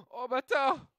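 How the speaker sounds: gasping, shrieking outburst; excited shout. Real, not acted. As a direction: shriek out in shock and delight. Gasp at food photo on screen.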